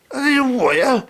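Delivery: loud, high, disbelieving shout into the receiver; shouting down the phone. Retold or imitated. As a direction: yell back over the crackle. Shouting down a crackly phone line.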